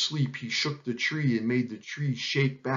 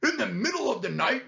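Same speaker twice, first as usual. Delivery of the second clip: indignant, raised-pitch lion complaint; growling lion; 9 semitones higher than usual. A narrator's reading. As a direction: growl it in disbelief. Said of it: "growled the lion".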